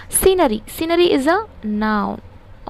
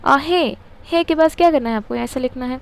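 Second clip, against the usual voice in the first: ordinary delivery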